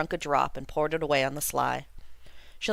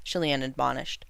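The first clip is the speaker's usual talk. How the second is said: ordinary delivery, nothing marked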